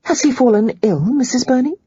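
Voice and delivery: worried and urgent; alarmed. Acted. Holly alarmed, questioning.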